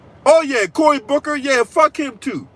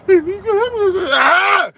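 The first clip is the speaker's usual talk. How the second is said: mock sob into laughter; 9 semitones higher, much slower than usual. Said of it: "he's like".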